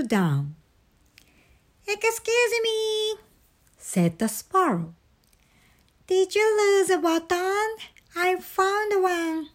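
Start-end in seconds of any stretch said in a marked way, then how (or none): 1.9-3.1 very high little bird voice; polite sparrow. A narrator's reading. Sparrow interrupts Frog and Toad, politely high-pitched. 6.1-9.5 high-pitched bird voice; helpful sparrow. A narrator's reading. Sparrow offers Toad a found button, helpfully high-pitched.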